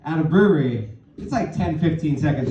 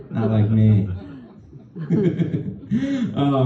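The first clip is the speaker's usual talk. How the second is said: normal talking voice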